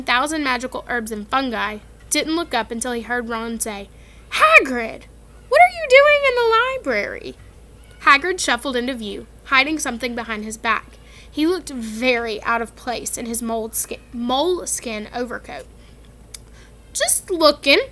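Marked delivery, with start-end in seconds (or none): 4.3-7.4 high, loud surprised call; startled to see him there. Acted. Ron surprised to see Hagrid. 16.9-17.8 high, loud, shifty Hagrid voice. Acted. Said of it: "he said in a shifty voice".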